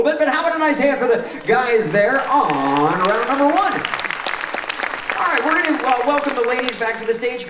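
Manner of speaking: loud, bright TV host hype; game show host. Acted. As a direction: pump up the studio crowd. Host hypes the audience.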